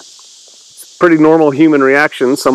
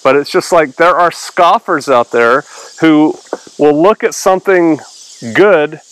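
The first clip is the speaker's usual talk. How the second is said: ordinary talk, nothing marked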